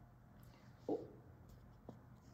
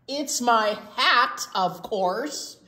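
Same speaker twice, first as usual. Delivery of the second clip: louder, fed-up outburst; exasperated shout; 5 semitones higher, 6 dB louder than usual. A narrator's reading. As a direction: finally snap back at them. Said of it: "Oh, Hedgie just shouted".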